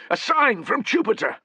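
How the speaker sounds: high, loud excited cry; overjoyed at the omen. Acted. Soldier crying out at the omen, excited.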